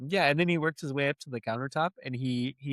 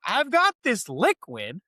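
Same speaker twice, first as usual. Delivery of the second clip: slow, high, loud sales pitch; mock pitch; 10 semitones higher, 5 dB louder, slower than usual. Lady offering spot remover, hammed-up slow pitch. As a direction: sell it slowly like a huckster.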